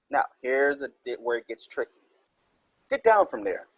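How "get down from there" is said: higher-pitched mild exclamation; Mister Jones exclaiming. Acted. Teacher voicing an example exclamation, mildly acted. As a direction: read it as a lightly acted order. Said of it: "Mister Jones exclaimed".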